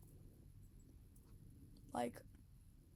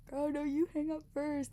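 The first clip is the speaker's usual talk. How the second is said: exasperated; 4 semitones higher, 5 dB louder than usual. Pretend. Exasperated repeat of phone bit.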